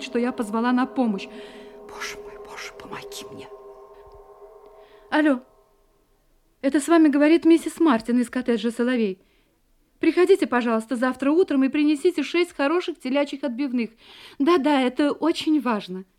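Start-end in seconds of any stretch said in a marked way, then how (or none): 1.9-3.5 hushed desperate prayer; terrified whisper. Acted. Wife praying in terror, whispering. 5.1-16.0 bright, loud phone manner masking fear; forced cheer. Acted. Wife fakes cheerful call, hiding fear.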